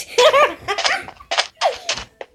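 high-pitched giggling burst; laugh. Real, not acted. Player amused by own crude answer, high laughter. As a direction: crack up at your own joke.

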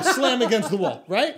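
ordinary talk

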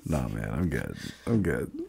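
low, soft retold brush-off; hushed, mock-nervous decline; wary refusal. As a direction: mumble it to get away. Retold wary refusal to street seller.

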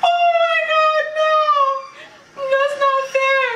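high panicked cry; frightened shout. Real, not acted. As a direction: scream it at the screen. Jump scare.

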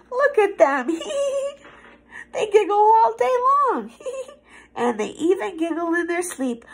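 high giggly voice breaking into laughs; giggling. Acted. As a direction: catch the giggles. Giggling monsters, infectious laughter.

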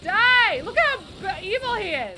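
loud, high outburst at an enemy; heated gamer yelling; combat shout. Real, not acted. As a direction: yell at the boss mid-fight. Shouting at boss in fight.

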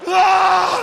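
sudden loud yelp; surprised yell. Real, not acted. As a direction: shout of surprise. Sudden loud game moment.

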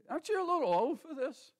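doubting teacher. Retold or imitated. Bible school teacher questions the preacher's age, mildly mocking.

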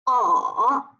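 slow 哦 dipping low, then rising; drawn-out dipping 哦; third tone. Shown as a demonstration. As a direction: bend 哦 down and back up. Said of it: "三声呢读哦".

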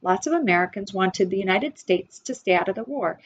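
usual speaking voice

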